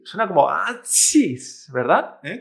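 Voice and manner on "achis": "achis" as an imitated sneeze; explosive pretend sneeze on "achis"; mock sneeze. Shown as a demonstration. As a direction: burst out "achis" like sneezing, drawn out. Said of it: "Como cuando estornudas".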